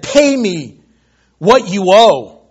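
loud, slow, forceful demand; menacing creditor, full volume; angry demand. Acted. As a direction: demand the debt with heavy punch on each word. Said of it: "took him by the throat".

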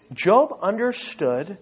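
ordinary talk, nothing marked

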